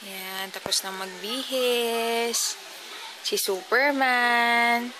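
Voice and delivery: put-on high, loud sing-song baby talk to a toddler. Mom coaxing her sleepy toddler to bed, in high baby talk. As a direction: coo at the little one like a doting mom.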